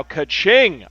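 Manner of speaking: loud, high sung ka-ching; cash register ka-ching. Pretend. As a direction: belt out a register chime. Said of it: "We need a cash register sound right now".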